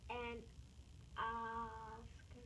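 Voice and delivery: "Oscar" with a long first vowel; stretched ah sound. Shown as a demonstration. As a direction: echo "Oscar", holding the "ah" opening. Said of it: "good job of stretching out".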